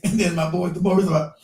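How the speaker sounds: ordinary talk, nothing marked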